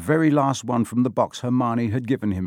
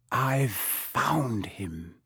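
very slow, hushed and awed whisper; 6 dB quieter, much slower than usual. A narrator's reading. Boy reveals his discovery to friends, whispering. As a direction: draw it out softly. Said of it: "he whispered".

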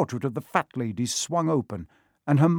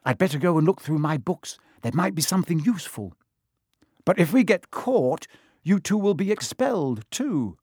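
brisk, higher-pitched practical voice; businesslike and pitched up; 7 semitones higher than usual. A narrator's reading. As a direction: take charge in a no-nonsense way. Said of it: "said Hermione briskly".

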